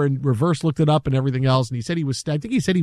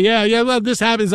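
cocksure; 5 semitones higher than usual. Retold or imitated.

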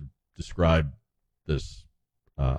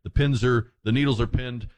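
normal talking voice

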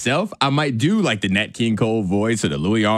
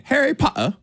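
high, lightly British "Harry Potter"; "Harry Potter" lifted high in a mock British lilt; British accent; 12 semitones higher than usual. Shown as a demonstration. Host trying to give her inner voice a British accent. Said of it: "I am focused on my inner voice saying".

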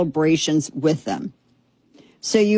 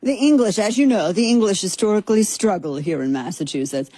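imitating the commentator; 2 semitones higher than usual. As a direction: echo the commentator's big-match call. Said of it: "Ghana have never beaten England".

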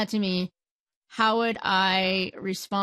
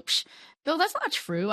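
scoffing, higher-pitched mock objection; mock dismissal; 8 semitones higher than usual. Listener denies Bill's hearing loss story, mock dismissive. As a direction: brush it off with a light scoff.

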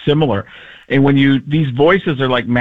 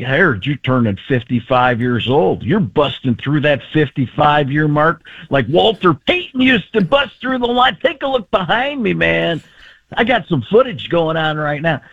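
John Gruden. Acted. Coach recording a birthday greeting, animated impression. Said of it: "nothing's better than John Gruden doing, like, a birthday greeting".

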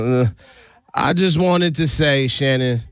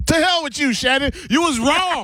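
high laughing gloat, caught out and teasing; laughing taunt; 12 semitones higher than usual. Real, not acted. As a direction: crack up while taunting her. Gloating after prank revealed.

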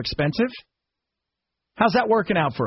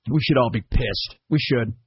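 ordinary delivery

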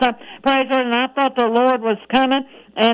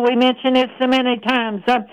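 ordinary delivery

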